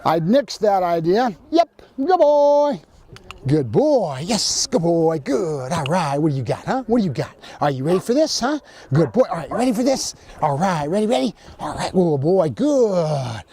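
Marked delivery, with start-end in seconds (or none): 1.5-2.8 high, stretched-out praise; dog praise. Real, not acted. Trainer rewarding his dog, high slow praise. 3.4-13.4 playful dog-talk with stretched praise and perky questions; pumping up the dog. Real, not acted. Trainer getting his dog pumped up, playful hyping voice. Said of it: "Notice how my voice tone is helping him get pumped up".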